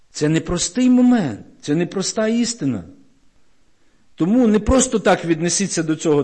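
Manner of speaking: ordinary talk, nothing marked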